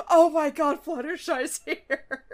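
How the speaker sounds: loud, stretched-out fake alarm with a laugh; mock panicked scream. Ponies fleeing as Fluttershy trots down, mock panic. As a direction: cry out in half-laughing mock terror. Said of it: "running and screaming".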